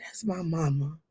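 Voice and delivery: whispered softly; tender whisper. Retold or imitated. Mother seeing her mother's photo, whispering. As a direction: hush it like a treasure.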